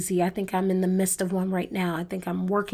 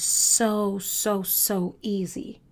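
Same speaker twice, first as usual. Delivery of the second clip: slow; much slower than usual. Real, not acted. Preacher drawls it slowly.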